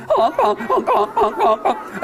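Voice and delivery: high, giggly honk honk; laughing goose honks. Shown as a demonstration. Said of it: "Do that with me".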